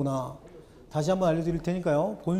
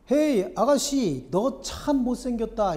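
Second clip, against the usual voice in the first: a lightly voiced bird, higher and slow, teasing; cheeky talking bird; 6 semitones higher, slower than usual. The bird mocks a passing young woman, in a lightly voiced, slow teasing tone. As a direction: drag out the taunt like a rude bird. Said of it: "이 새가 이렇게 얘기합니다".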